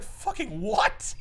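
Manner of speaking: slow, stretched-out disbelief; incredulous Kingpin. Retold or imitated. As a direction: drag it out like you can't believe your ears. Kingpin's outraged reply.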